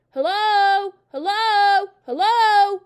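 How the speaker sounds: high, very loud repeated calls; loud, clear parrot. A narrator's reading. Hidden parrot calling from the dining room, loud and clear. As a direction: ring out across the hall. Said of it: "a voice came loud and clear".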